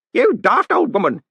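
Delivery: put-on high, loud, slow old man yell. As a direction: yell it at the crowd. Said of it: "Groat yelled".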